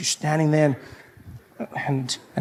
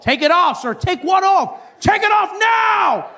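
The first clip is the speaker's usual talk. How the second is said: shouting officer; 12 semitones higher, slower than usual. Retold or imitated. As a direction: bark the command at him. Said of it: "They're like".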